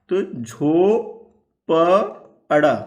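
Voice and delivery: झो then प अड़ा spelled out very slowly, syllable by syllable; sounding out syllables. Shown as a demonstration. Teacher spells out झोपड़ी for pupils syllable by syllable. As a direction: break the word into its syllables.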